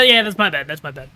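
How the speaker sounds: ordinary talk, nothing marked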